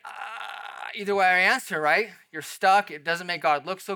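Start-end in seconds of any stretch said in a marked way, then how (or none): 0.1-0.9 long, high, softer stalling "ah"; stumped "ah". Acted. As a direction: imitate being stuck for an answer with a drawn-out "ah". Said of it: "And you're like".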